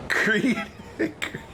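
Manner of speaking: high, squeezed out through real laughter; laughing hard. Real, not acted. Gamer repeating a silly business name, laughing hard. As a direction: choke the words out while laughing.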